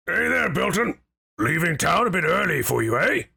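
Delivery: casual, rough greeting from a city guard; gruff guard. Acted. Guard greeting Belton at the checkpoint, lightly gruff. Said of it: "a gruff voice say".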